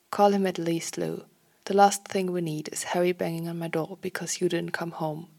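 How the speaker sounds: ordinary delivery, nothing marked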